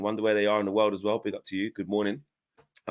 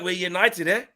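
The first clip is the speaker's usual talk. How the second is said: imitating Ten Hag; 9 semitones higher than usual. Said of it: "he was like".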